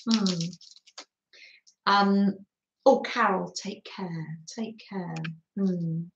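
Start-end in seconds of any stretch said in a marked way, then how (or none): 3.5-5.1 whispered goodbye. Real, not acted. Saying goodbye to a viewer.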